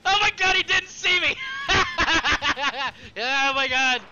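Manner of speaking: very high, very loud disbelief; real shrieking laughter. Real, not acted. Gamer to viewers after going unseen, shrieking laughter. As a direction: shriek with relief, breaking into laughter. Escapes being seen, whoops laughing.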